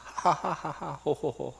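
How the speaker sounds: a hammed-up ha-ha-ha, ho-ho-ho; mock laughter. Villagers gloating over their free cash, hammed-up laugh. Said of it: "하면서".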